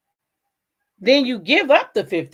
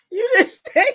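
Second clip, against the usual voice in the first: high, laughing mockery; laughing through words; 10 semitones higher than usual. Real, not acted. Mocking the plaintiff who missed the hint, laughing. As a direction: talk while laughing at him.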